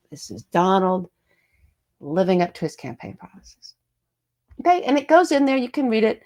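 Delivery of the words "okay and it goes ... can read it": ordinary talk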